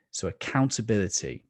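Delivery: normal talking voice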